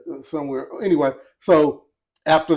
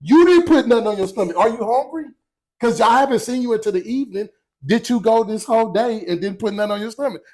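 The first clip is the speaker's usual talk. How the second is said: higher-pitched mock-indignant grilling; incredulous questioning; 6 semitones higher than usual. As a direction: question them in playful disbelief. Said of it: "But you making it like".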